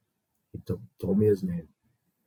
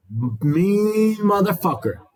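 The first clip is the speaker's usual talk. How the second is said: drawn out, much higher and louder, hammed up; mean tough guy; 10 semitones higher, 4 dB louder, much slower than usual. Old guard boasting how mean he once was, mock menacing. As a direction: growl it like the worst guard in the jail.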